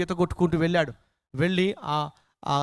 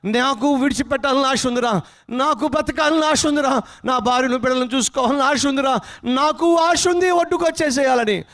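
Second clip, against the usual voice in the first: loud desperate shouting; 7 semitones higher, 3 dB louder than usual. Acted. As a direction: shout back as a drowning man would. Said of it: "వాడు అక్కడి నుంచి గట్టిగా అరుస్తా". (ప్రాణభయంతో గట్టిగా అరుపు)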